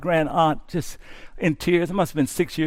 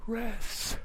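soft rest; 3 semitones higher, 14 dB quieter than usual. Shown as a demonstration. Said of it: "Come to me".

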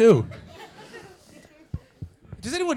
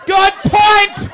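high loud shouted approval with a laugh; delighted agreement; 14 semitones higher, 14 dB louder than usual. Retold or imitated. Comic agreeing with Scots about curry breakfast, shouting. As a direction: cheer the answer like a convert. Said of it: "Why the fuck not, pal?".

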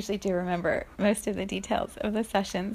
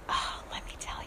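whispered; 9 dB quieter than usual. Retold or imitated. Starting to tell the sad woman.

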